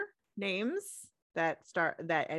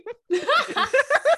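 loud burst of laughter; 11 dB louder than usual. Real, not acted.

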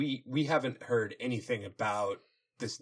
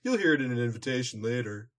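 mock brother voice; 6 semitones higher, faster than usual. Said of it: "Twilight pretending to be Shining Armor".

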